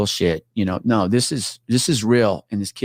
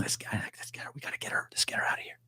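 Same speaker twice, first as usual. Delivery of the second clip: urgent whisper; 15 dB quieter, faster than usual. Retold or imitated. Cop whispers to his partner to grab the woman. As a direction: hiss it to your partner so she won't hear.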